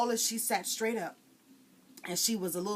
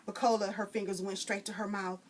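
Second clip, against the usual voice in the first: plain reading voice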